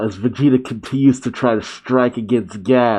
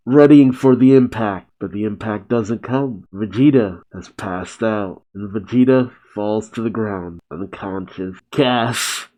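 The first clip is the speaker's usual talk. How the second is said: plain reading voice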